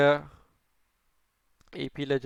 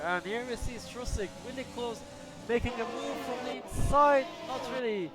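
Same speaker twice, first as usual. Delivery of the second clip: higher, excited play-by-play; 6 semitones higher than usual. Real, not acted. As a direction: rise with the overtake attempt. Live call of an overtake attempt.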